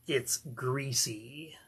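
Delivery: slow, soft relishing whisper; greasy whisper. Real, not acted. Record collector relishing a greasy soul single, slow whisper. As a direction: breathe the word out slowly like a secret.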